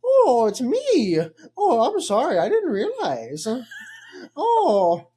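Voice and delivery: a doddering old toff playing innocent; transatlantic old. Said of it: "transatlantic old".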